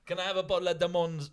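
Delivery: quick, put-on Caribbean accent ordering a drink. Ordering a drink in Spain, mock Caribbean accent. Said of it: "I don't know why I'm doing that accent".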